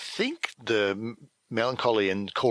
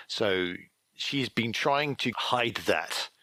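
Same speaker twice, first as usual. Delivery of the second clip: ordinary talk, nothing marked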